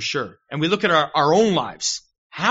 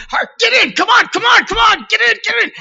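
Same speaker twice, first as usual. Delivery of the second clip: loud, much higher shout hurrying his wife; impatient yelling; 9 dB louder than usual. Retold or imitated. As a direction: bark at her to get in the car, fast. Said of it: "he yells at his wife".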